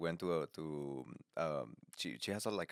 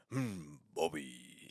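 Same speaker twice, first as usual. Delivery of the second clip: slow, low, quieter mock Chinese-accented dad; 5 semitones lower, 7 dB quieter, much slower than usual. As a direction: drawl it like a stern Chinese father. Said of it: "That's like a Chinese accent".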